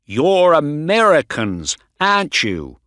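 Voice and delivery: angry accusation. A narrator's reading. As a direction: throw it at them as a loud accusation. Angry doctor confronts the visitors.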